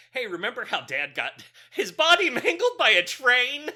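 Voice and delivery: loud, high retelling breaking into laughter; laughing mock quote. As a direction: crack up while imitating the character. Voices Gustavo's line, breaking into laughter.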